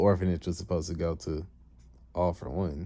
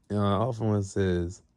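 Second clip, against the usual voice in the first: ordinary delivery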